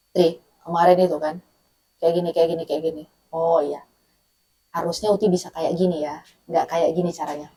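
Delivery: low, soft, composed retold exchange; calm advice. Mother advising her calmed-down child, calmly and quietly. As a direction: speak gently once the anger has passed. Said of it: "dinasihati dalam keadaan sudah tenang".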